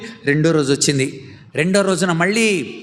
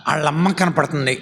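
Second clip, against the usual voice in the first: ordinary delivery, nothing marked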